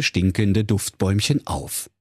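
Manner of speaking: usual speaking voice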